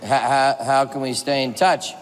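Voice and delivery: low, halting, tentative question; imitating a confused man stumbling over words; Warren Beatty. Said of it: "Warren Beatty looks at me and goes".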